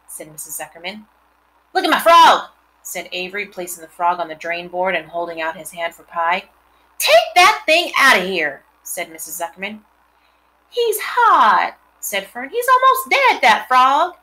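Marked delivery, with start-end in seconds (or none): 1.7-2.5 put-on excited boy. Boy shows off his frog. 7.0-8.6 bossy aunt. Acted. Aunt orders frog away. 10.7-14.1 put-on little girl. Girl voiced in story.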